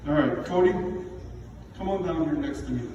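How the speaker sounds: normal talking voice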